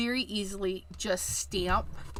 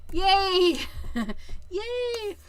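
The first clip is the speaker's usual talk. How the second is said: much higher, a giddy little yay; little cheer; 8 semitones higher than usual. Real, not acted. Crafter cheering that the mat is sticky again.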